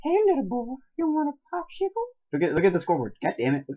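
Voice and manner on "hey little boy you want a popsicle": very high, comic character voice; put-on stranger voice. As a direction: coax a child in a silly squeaky pitch. Luring stranger bit to provoke laughter.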